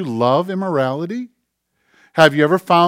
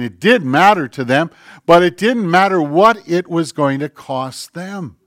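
fiery preaching; 2 semitones higher, 5 dB louder than usual. Acted. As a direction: press the crowd hard from the pulpit. Preaching at full force.

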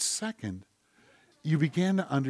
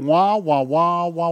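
fading wah wah; 4 semitones higher, 8 dB louder, slower than usual. Retold or imitated. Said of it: "all you've done is heard".

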